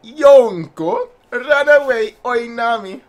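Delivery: high, loud mock warning; imitated alarm. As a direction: call out an exaggerated warning. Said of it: "He's like".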